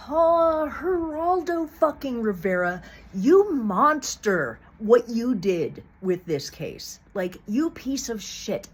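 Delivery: high, loud, slow furious accusation; dragged-out enraged yell at Geraldo; furious outburst. Real, not acted. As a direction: spit it at him like a curse. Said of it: "I'm just mad".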